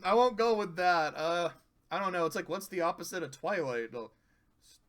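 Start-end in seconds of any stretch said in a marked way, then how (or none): none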